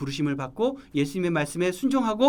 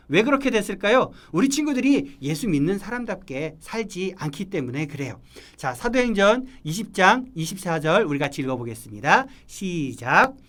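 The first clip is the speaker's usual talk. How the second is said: ordinary talk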